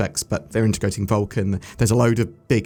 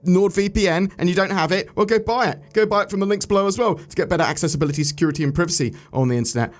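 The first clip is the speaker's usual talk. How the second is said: ad read; 7 semitones higher than usual. Acted. NordVPN ad read.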